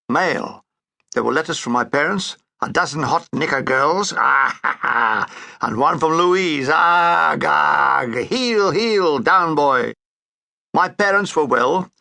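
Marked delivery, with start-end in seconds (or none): none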